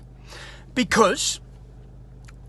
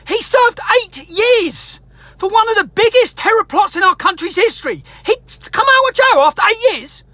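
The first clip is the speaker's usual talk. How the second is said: raised, higher, heated ranting voice; furious vlogger ranting at the system; angry rant; 7 semitones higher than usual. Real, not acted. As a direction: let the outrage climb and keep pushing. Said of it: "whilst I'm on a little rant".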